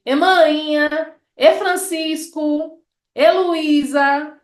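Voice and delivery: slow Baixo Sul calling tune; E intonation. Shown as a demonstration. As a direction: call out "E Francisco" drawn out. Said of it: "essa entonação".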